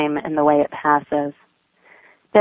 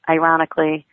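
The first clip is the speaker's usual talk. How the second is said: ordinary delivery, nothing marked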